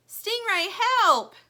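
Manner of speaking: high, loud desperate call; crying for help. Acted. Lumpy stuck in snow calls Stingray, desperate. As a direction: call for help across the yard. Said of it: "cries Lumpy".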